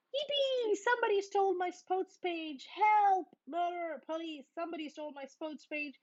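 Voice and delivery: high, excited yelps of alarm; mock alarm. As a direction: cry for help like a panicked papa. Cub-papa yells about the paper.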